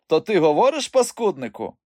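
high, loud accusing question; indignant surprise. A narrator's reading. Uncle to the fake mute, indignant surprise.

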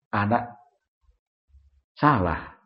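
ordinary talk

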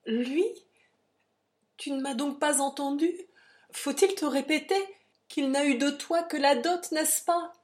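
loud, high exasperated questioning; heated, impatient protest; indignant retort. A narrator's reading. Lovers' quarrel, her indignant retort.

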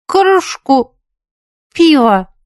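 high, halting tipsy reply; drunk, struggling words. A narrator's reading. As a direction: force out each word with effort. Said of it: "с трудом выговорила".

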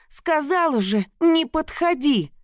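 fierce warning. A narrator's reading. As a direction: warn him off fiercely. Heroine warns husband away, knife drawn.

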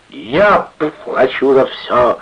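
put-on slow, loud drunken roar, pitched high. A narrator's reading. Drunken sailor treating the gang, roaring. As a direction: bellow your generosity to the room. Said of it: "ревел матрос".

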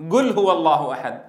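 قل said with a hard g, like gul; qaf as hard g. Retold or imitated. Teacher imitates the eastern dialect qaf, a hard g. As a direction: say قل with a Gulf hard g. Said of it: "كيف ينطق القاف؟".

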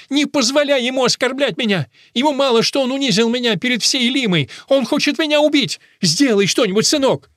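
high, loud, frantic appeal; distraught mother crying out; desperate cry. A narrator's reading. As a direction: beg your son to protect you. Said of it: "закричала мама в отчаянии".

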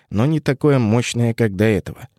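usual speaking voice

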